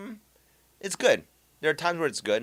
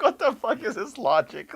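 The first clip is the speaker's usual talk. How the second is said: higher, disbelieving outburst through laughter; laughing disbelief at a streamer's reasoning; incredulous; 10 semitones higher, 4 dB louder than usual. Retold or imitated. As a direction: act baffled by absurd logic. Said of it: "I'm like".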